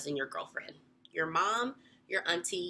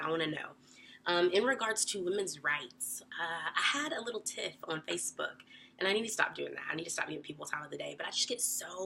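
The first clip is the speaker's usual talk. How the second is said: ordinary talk, nothing marked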